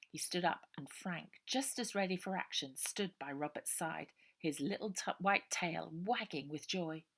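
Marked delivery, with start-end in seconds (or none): none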